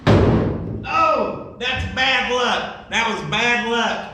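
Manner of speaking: high, slow groan of disappointment; a slow, high, mock-glum oh, that's bad luck; mock dismay. Real, not acted. Engine fails to start.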